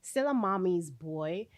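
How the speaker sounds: ordinary talk, nothing marked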